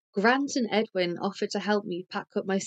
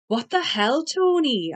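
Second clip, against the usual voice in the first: raised, flustered protest; 6 semitones higher, 5 dB louder than usual. A narrator's reading. Narrator confronted by an angry Tony, flustered protest. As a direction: jump up high on the name.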